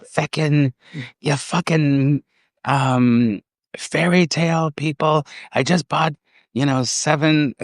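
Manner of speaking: imitating the woman in the car, pitched well up, ranting. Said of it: "she was like".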